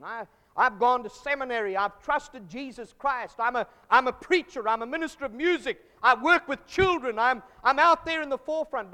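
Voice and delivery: loud, boastful church member listing his credentials; mock self-righteous boaster. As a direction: puff yourself up, mockingly proud. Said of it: "mocking a self-righteous member".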